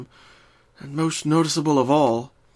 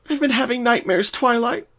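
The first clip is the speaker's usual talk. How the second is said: higher, hesitant confession; Pinkie voice; 11 semitones higher, 4 dB louder than usual. Acted. Pinkie admits her nightmares to Twilight, higher. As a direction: finally admit it.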